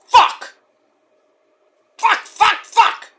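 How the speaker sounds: high, loud, repeated cursing; frustrated yelling. Retold or imitated. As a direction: scream it in mock frustration. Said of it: "But I'm like".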